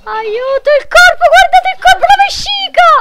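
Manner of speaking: shrieking mock horror. Gamer shrieking at viewers over the gory body, hammed-up disgust. Gross body scene in game.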